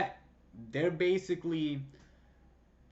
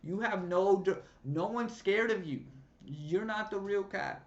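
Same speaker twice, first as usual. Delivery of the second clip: worked-up retort; 6 semitones higher than usual. Retold or imitated. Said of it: "All right, let me relax".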